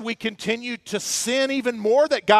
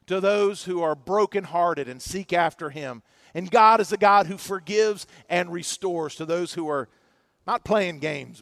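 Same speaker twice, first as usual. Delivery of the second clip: usual speaking voice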